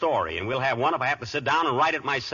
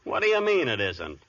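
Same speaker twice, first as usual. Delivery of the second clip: ordinary talk, nothing marked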